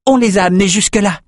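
high, quick call; urgent, fast, high-pitched shout. A narrator's reading. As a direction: fling the report out to allies. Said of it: "lança Peretta".

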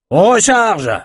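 very high, loud call to reload; barked command. A narrator's reading. Captain to his archers between volleys, loud high command. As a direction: throw the order out hard. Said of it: "lança Stuart".

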